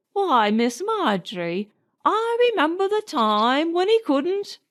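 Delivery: put-on fond grumbling old nanny. Nanny scolds and reminisces.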